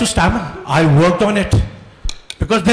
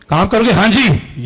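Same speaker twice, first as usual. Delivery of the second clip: energetic reply; much faster than usual. Shown as a demonstration. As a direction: answer with energy and readiness. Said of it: "energetic चाहिए कि".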